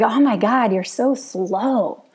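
lightly mocking husband, slow drawn-out; teasing husband. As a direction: tease her like a husband would, stretching the words. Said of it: "he makes fun of me a lot".

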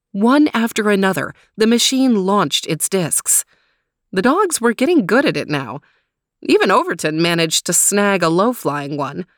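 ordinary delivery, nothing marked

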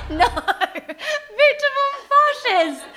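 slow, high, laughing through words; can barely say it for laughing. Real, not acted. Laughing at the silly name.

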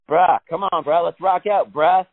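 rally your guitar like a buddy; hyped-up bro. Acted. Acted pep talk to his guitar.

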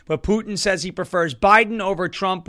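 ordinary delivery

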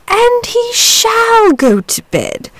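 high, slow emphatic outburst; dragon cries out. A narrator's reading. Dragon starting up to defend the boy, emphatic cry. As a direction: leap up with sudden resolve. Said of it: "cried the dragon".

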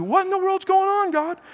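high, baffled plea to God; bewildered cry. Retold or imitated. A suffering believer asks God what is happening, high and baffled. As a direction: throw up your hands in disbelief.

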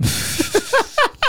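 shrill burst of laughter; high squealing laugh. Real, not acted. Laugh at the shooting story.

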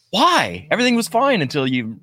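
high-pitched, mock-baffled protest; exasperated reaction. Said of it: "the way he ends each line is like".

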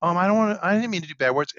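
put-on upset little boy. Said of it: "those are bad words".

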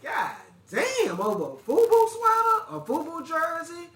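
high-pitched stunned exclamation; can't believe it over a sweater; shocked disbelief. Retold or imitated. Shocked retold reaction.

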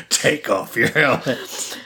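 very slow, drawn-out mock command; hammed-up slow imitation of a forceful order. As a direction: stretch the order out to tease. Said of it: "say it kind of forceful".

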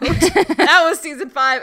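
laughing through the words. Real, not acted. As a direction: giggle while announcing. Laughing sign-off.

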